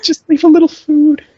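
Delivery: high, hammed-up heroic farewell; melodramatic last words. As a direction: play a dying hero. Mock heroic sacrifice.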